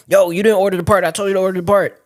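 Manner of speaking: mock yell at a worker who slipped up; imitated yelling. Shop owner chewing out his mechanic over the part, mock yelling. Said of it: "is he yelling at him like".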